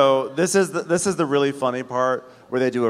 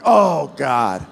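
exasperated; 6 dB louder than usual. Acted. Exasperated aside after Frank's yelling.

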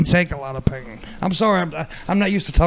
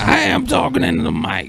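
Pimp Monk voice; 7 semitones higher than usual. Retold or imitated. [name] copies Pimp Monk for the chat, a put-on higher voice. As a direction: mimic his mumbly way of talking, pitched up. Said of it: "in your Pimp Monk voice".

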